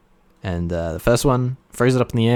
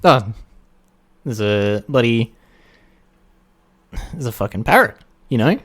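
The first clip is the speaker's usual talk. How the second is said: normal talking voice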